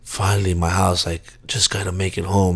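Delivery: soft, low murmur of relief; relieved drunk mumble. Retold or imitated. Drunk guy spotting his house, murmuring quietly in relief. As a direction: murmur it like the end is finally in sight. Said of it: "I'm like".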